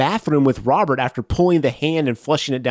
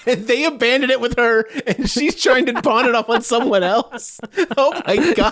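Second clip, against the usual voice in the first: laughing it out, higher and giddy; 8 semitones higher than usual. Real, not acted. Host cracking up at abandoned-baby theory, laughing high. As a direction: giggle through the words as the idea lands.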